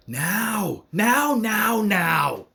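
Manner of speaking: rising from a cry into a full loud shout; a rallying cry that breaks into shouting; urgent shout. Real, not acted. Rallying call for action now.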